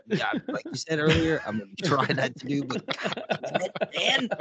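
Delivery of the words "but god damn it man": laughing exasperation. Real, not acted. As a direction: lose it laughing at your own suffering.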